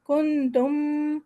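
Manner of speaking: کنتم very slow, nun and final meem both held nasal; slow ghunna recitation. Shown as a demonstration. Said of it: "نون پہ بھی دیں گے".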